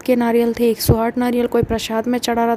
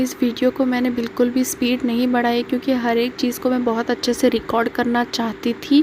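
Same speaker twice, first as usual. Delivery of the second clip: ordinary talk, nothing marked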